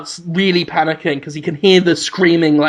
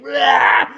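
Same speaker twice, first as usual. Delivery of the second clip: a mock growling roar; monster roar; 6 semitones higher than usual. Willem Dafoe face in the spunk, roaring. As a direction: roar at the camera.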